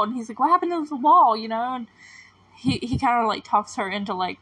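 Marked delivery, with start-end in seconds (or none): 0.4-1.4 higher, louder imitation of a baffled son; mock-incredulous demand for an explanation; retold alarmed question. Son challenging his mother about the wall, incredulous.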